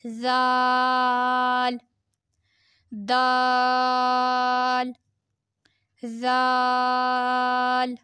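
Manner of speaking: each letter stretched on a level tone; drawn-out letters. Shown as a demonstration. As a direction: hold every vowel slowly and evenly. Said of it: "پانچ انگلیوں کے برابر کھینچیں گے".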